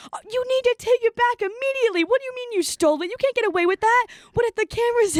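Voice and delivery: high, frantic scolding mother; high-pitched mom flipping out; panicked mom. Retold or imitated. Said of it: "She goes".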